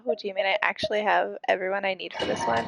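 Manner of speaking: usual speaking voice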